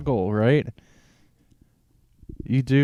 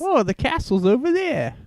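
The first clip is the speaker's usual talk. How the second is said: very high mock-British film voice; Labyrinth impression; 4 dB louder than usual. Co-host feeds a film line, high put-on British voice. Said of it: "do the grubworm from Labyrinth".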